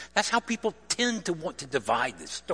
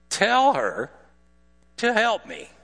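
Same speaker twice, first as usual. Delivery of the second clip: much higher, louder mock outburst; frustrated demand; 8 semitones higher, 6 dB louder than usual. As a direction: demand it at the end of her rope. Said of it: "she stomps in".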